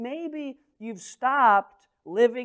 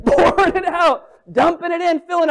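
laughing; 9 semitones higher, 6 dB louder than usual. Real, not acted. Laughing while describing.

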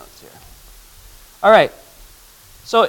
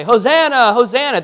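high, raised cry of a crowd; pitched-up public acclamation; crowd crying hosanna; 6 semitones higher, 4 dB louder than usual. Retold or imitated. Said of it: "when they say".

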